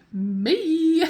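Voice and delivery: high, drawn-out excited "me"; gleeful announcement. Real, not acted. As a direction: proudly sing out your own claim. Announces she will get game.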